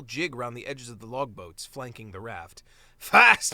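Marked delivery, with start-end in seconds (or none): 3.0-3.5 FAST, bellowed much louder and higher; gleeful shout. Acted. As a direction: shout fast to tease the frightened mole. Captain teases mole.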